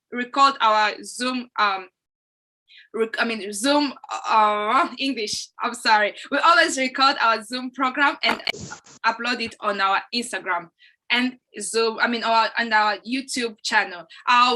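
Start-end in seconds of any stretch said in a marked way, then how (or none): none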